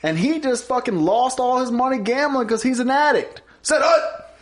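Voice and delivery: high, loud mock quarterback call; Peyton Manning. Quarterback exposing an opponent on the field, mock shouted call. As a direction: shout the insult like a snap count. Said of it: "he really was like".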